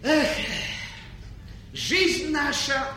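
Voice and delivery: soft, drowsy sigh; sleepy goodnight. Acted. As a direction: fade out toward sleep. King sleepily saying goodnight.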